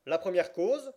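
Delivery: loud, high call with rising end; judge calling a case. A narrator's reading. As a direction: call it out as a question, pitch lifting. Judge calls the first case. (appel sonore du juge, ton montant)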